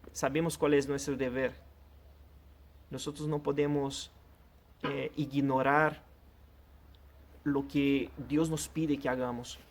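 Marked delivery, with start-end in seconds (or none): none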